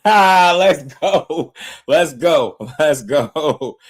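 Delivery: loud, high let's go, laughing with joy; excited cheering. Real, not acted. Streamer hyping the upcoming fight to chat, loud and joyful. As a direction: cheer it out like your fighter won.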